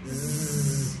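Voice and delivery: long, soft "zzz" buzz; drawn-out teacher's demo of a buzzing Z; sustained buzzing Z sound. Shown as a demonstration. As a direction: hold out a quiet voiced Z like a teacher demonstrating. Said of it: "được phát âm là âm".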